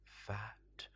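plain reading voice

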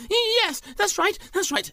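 very high, loud eager agreement; squeaky nervous thief. Acted. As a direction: agree too quickly in a squeaky voice.

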